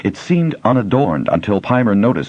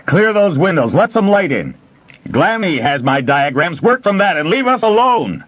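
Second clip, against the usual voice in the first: shouted orders; 12 semitones higher than usual. A narrator's reading. Smith ordering the miners above, shouting.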